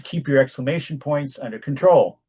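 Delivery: ordinary delivery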